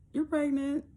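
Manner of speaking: high but restrained nurse imitation; understated announcement. Nurse telling patient she's pregnant, underwhelmed. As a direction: state it without any celebration. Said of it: "It was just like".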